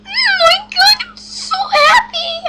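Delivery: very high, loud squeal; ecstatic squeal. Real, not acted. Player thrilled by a trade, ecstatic squealing. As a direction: squeal with overflowing joy.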